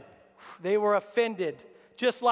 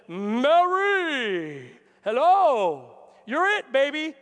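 angel's greeting; 5 dB louder, much slower than usual. Retold or imitated. Angel greets Mary in retold story.

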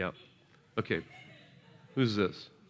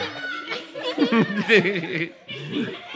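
higher rolling laugh; long laugh; 11 semitones higher than usual. Real, not acted. Preacher laughing after shouting fire, higher.

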